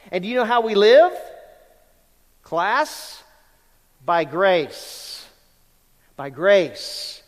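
loud, punchy preaching with hammered words; full-force preaching. Acted. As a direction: drive home grace to the congregation.

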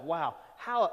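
usual speaking voice

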